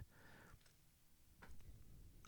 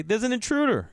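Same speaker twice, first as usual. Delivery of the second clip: mock dramatic; 10 semitones higher than usual. Mocking the word intruder.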